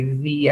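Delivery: "the" said as "thee", with a long ee; old "thee" pronunciation. Shown as a demonstration. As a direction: say "the" to rhyme with see. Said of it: "we used to say V".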